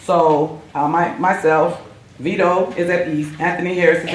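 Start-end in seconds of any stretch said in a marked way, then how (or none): none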